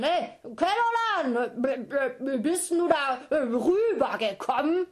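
high, loud, slow call across the river; giant mother calling. Acted. Giant mother calls to Kelolan across the river, high and loud. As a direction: stammer it across the water, baffled. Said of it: "Riesenmutter auf der anderen Seite rufen".

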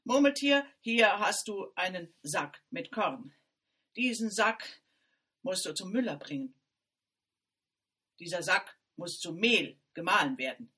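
stern order. A narrator's reading. Stepmother orders the errand.

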